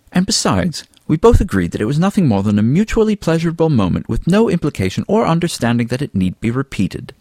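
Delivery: lightly voiced Fabian. A narrator's reading.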